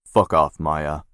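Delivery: irritated brother snapping at his sister; curt brush-off. Acted. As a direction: shove her away with the words. Brother snaps back at sister.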